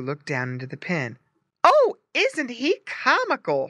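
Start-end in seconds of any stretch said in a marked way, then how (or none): 1.6-3.7 high, loud, slow delighted exclaim; wife charmed by the pig; delighted cry. A narrator's reading. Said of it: "she cried".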